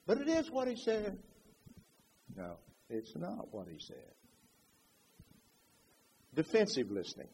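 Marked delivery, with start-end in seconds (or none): 0.0-1.2 high, soft protest; lightly acted contradiction, pitched up; insistent objection. 2.4-4.2 low, soft denial; hushed, low rebuttal; quiet correction. Acted. Calmly answering the acted objection.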